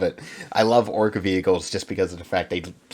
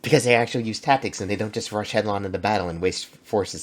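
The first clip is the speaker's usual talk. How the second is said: ordinary delivery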